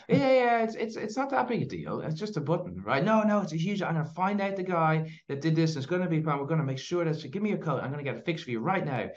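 backpedalling lady. Retold or imitated. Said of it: "she's like".

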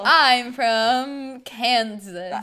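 loud, very slow declaiming; big corny stage-heroine delivery; stage Dorothy. Acted. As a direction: stretch every word, hammed-up. Mock improvising as Dorothy.

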